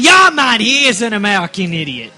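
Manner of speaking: a bad British accent that slips Australian; mock a British bloke, loud; loud fake British accent; attempted British accent. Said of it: "You sound Australian".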